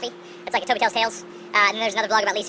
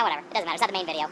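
ordinary talk, nothing marked

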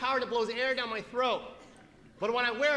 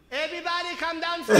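high, loud mother calling the house; 7 semitones higher, 8 dB louder than usual. Retold or imitated. Son imitates mom gathering an audience, calling out. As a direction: imitate a mom summoning everyone.

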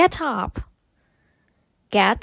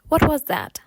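quicker, connected phrase; faster; 3 semitones higher than usual. Shown as a demonstration. Teacher models the question for learners, faster. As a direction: run the words together at natural speed. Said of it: "потім спробувати швидше".

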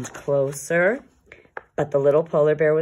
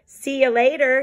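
higher, louder farewell; cheerful cry; 8 semitones higher, 5 dB louder than usual. Acted. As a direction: call out as you leap away. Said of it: "he cried".

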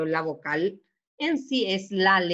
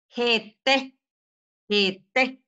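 "Jete" with a strong, rising TE; stress on final E; 4 semitones higher, 3 dB louder than usual. Shown as a demonstration. Teacher shows the rule, "jete" stressed on final TE. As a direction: hit the last E of "jete" each time. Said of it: "Suena más fuerte esta E".

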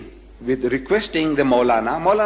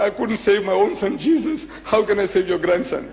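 voice of God; 7 semitones higher than usual. Retold or imitated. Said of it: "He said".

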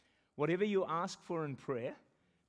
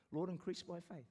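quiet prayer; 8 dB quieter than usual. Retold or imitated. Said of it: "I've started to say".

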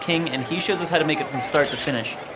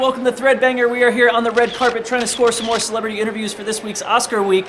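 gushing red carpet chatter; 6 semitones higher, 5 dB louder than usual. Acted. Red carpet host greeting celebs.

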